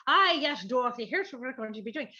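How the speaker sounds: put-on a lightly done Irish accent, loud start; hammed-up Irish brogue. Reading Oz to kids, a loud Irish accent. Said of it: "randomly an Irish accent".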